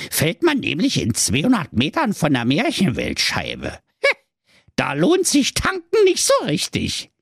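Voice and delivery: squeaky Berlin-tinged dwarf, chatty; a cheeky high gnome voice. Acted. The dwarf explains the world edge to Marco, squeaky.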